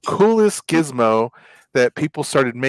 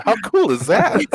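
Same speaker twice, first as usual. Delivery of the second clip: higher, laughing through the words; laughing delight; 9 semitones higher than usual. Retold or imitated. Telling hosts about his engineering team, laughing. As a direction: giggle at your own excitement. Retelling own delighted reaction.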